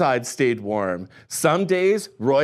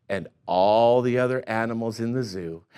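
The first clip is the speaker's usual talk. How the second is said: usual speaking voice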